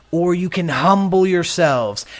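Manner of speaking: usual speaking voice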